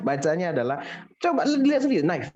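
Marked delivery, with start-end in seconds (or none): 2.0-2.3 "knife" as "naif", silent K; one syllable "knife", from the N; correct knife. Shown as a demonstration. Teacher citing the dictionary, knife as "naif". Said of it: "Bacanya itu".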